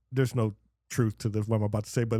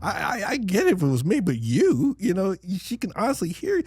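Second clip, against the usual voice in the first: retold indignation; 6 semitones higher than usual. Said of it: "and I'm like".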